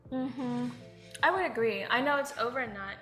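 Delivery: ordinary talk, nothing marked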